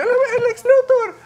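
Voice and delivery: very high squeaky helium voice. Pretend. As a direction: talk like you just inhaled helium. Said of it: "va hablando así con helio".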